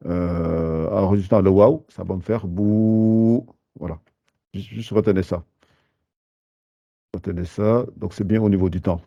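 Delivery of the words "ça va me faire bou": "bou" held twice as long, slow; long vowel. Shown as a demonstration. Teacher demonstrates the lengthened vowel to students, slow. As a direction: draw out "bou" to a long buu. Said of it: "le temps que ça me prend de faire deux fois la lettre bou".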